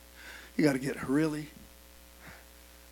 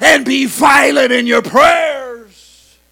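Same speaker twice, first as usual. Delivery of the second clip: loud, higher chanted preaching, slowing at the end; preaching at full force; 5 semitones higher, 14 dB louder than usual. Acted. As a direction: rise to a sung sermon climax.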